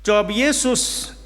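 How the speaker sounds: ordinary delivery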